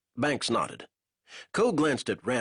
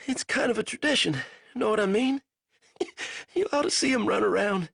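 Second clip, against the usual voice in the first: put-on raised, strained young soldier's voice; a weak captive fumbling for words; lightly voiced delirious prisoner; feverish prisoner; 12 semitones higher than usual. A narrator's reading. Banks voice, laughing.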